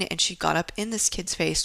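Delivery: usual speaking voice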